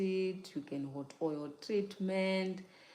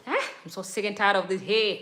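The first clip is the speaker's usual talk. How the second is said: acted exasperated complaint, a little louder; fed up; 3 semitones higher, 7 dB louder, faster than usual. Voicing a viewer fed up while taking down braids, exasperated. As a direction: play someone sick of their hair. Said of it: "now you're like".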